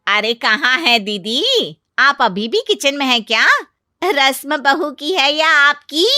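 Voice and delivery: loud, high, needling aunt; taunting aunt-in-law. Acted. Aunt-in-law taunts her sister-in-law about the new bride's kitchen ritual, mocking. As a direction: tease loudly with pointed questions.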